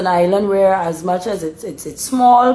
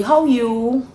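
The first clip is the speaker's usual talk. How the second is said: "how you" lifted higher on a rising tune; concerned intonation; 6 semitones higher than usual. Shown as a demonstration. Explaining the Bajan greeting, "how you" rising in concern. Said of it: "the intonation is, is more about concern".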